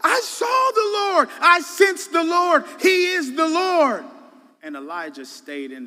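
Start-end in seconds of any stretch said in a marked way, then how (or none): 0.0-4.0 much higher, much louder ecstatic cry; ecstatic shout. Pretend. Imagined Elijah shouting that he saw the Lord, ecstatic. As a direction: shout it out like you just saw God. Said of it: "I'm going bananas".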